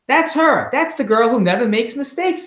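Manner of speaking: high, eager whisper-gossip of a fan; excited woman voice. Acted. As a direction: point her out like a starstruck fan. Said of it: "said a woman".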